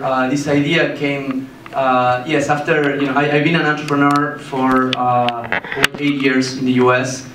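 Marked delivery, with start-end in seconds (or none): none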